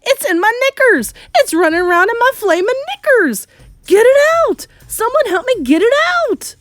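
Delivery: high, loud comic hysteria; squealing in sheer panic; panicked screaming. A narrator's reading. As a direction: shriek frantically for someone to help. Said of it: "the man was screaming".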